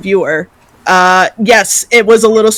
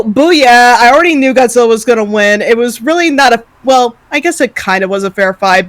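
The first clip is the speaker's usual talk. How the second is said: normal talking voice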